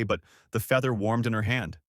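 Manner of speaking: ordinary delivery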